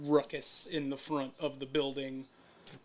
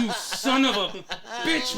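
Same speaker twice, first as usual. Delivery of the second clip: loud exasperated laughing curse; mock fury through laughter; 4 semitones higher, 4 dB louder than usual. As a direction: snap it while cracking up. Teller's exasperated retort.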